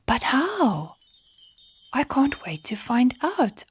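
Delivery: high excited tiny voices; eager little stars. Acted. Little stars begging Stella to tell them, high and eager. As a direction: plead like small bouncing stars.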